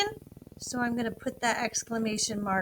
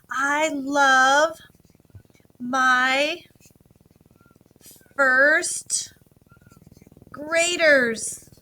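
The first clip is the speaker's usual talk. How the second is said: slow dictation; 3 semitones higher, 8 dB louder, much slower than usual. Shown as a demonstration. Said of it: "I'm gonna leave spaces between my words".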